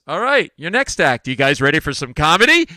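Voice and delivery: big, loud MC introduction; stage announcer. Retold or imitated. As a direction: bring on the next act over the noise. Imitates host introducing him on stage.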